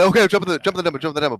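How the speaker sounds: loud, high repeated orders; retold screaming. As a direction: reenact yelling orders at teammates. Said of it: "And I'm screaming".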